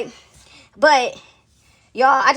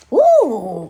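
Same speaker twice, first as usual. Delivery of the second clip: exclaimed woo; 2 semitones lower, 5 dB louder than usual. Retold or imitated. Storyteller reliving the walk out of church, whooping "woo". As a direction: let out a long rising whoop.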